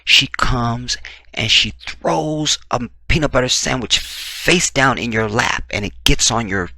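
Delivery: usual speaking voice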